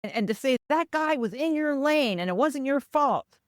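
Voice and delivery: high, emphatic imitation of a loyal grandson; funny grandson. Grandson offers to testify for his grandmother, earnest. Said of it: "my grandson was so funny".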